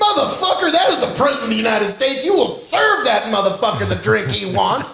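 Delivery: hammed-up indignant yelling; mock furious rant. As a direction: rant at them like an offended fan, half laughing. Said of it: "I was like".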